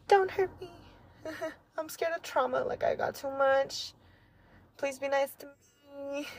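high, small, frightened voice; mock scared plea. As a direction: plead like a skittish rescue dog. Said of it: "I'm like".

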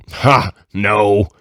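mildly exclaimed rejection, narrated; exclaiming. A narrator's reading. Satan rejecting the imp's plan, exclaiming. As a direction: read it as a scornless, emphatic exclamation. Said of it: "exclaimed Satan".

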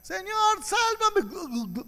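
high, loud plea from a sinking man; desperate cry for help. Retold or imitated. Preacher voicing Pedro crying out to the Lord, desperate high shout. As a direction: cry out as if going under the waves. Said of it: "Él comenzó a gritar".

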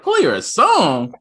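high, loud disbelief; incredulous. Retold or imitated. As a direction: play her a song, stunned. Dad incredulous quoting himself.

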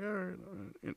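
resigned agreement. Retold or imitated. Byrd gives in to saucers.

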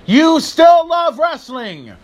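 high, loud and slow; emphatic yell. Real, not acted. Telling fans they love wrestling, a slow high yell. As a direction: drive it home to the fans.

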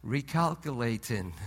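low, soft patient sat-nav voice; gentle GPS voice. Retold or imitated. Preacher giving God's reply as the GPS, low soft patient voice. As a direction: say it gently like a forgiving guide. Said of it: "He just says".

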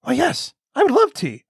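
wide-eyed acted delight; naive. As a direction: play the innocent, happily accepting guest.

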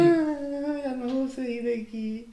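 high, slow, whiny imitation of a panicking girl; mock crying girl. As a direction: ham up a tearful wail, drawn out. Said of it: "e começou a chorar, baba e ranho".

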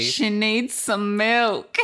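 high, slow, drawn-out exclamation; hammed-up meme quote. Host riffing on milk with co-host, hammed-up meme quote. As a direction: stretch it out high like a viral quote.

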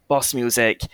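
ordinary delivery

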